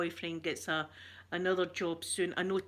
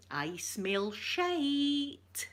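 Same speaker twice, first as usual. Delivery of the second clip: put-on very slow, drawn-out old lady sniff; wee Scottish lady; 5 semitones higher, much slower than usual. Imitating a TV sketch lady, slow and disdainful. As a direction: drag it out like a disgusted wee lady. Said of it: "that's what she does".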